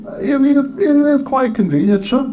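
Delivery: very high, stammering clerk; timid clerk. Acted. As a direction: stammer a deferential answer in a high voice. Timid clerk answers Scrooge.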